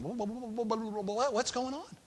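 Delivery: high, soft confused sputter; flabbergasted. Acted. Brothers hearing shalom from an Egyptian, sputtering confusion. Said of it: "they had to be going".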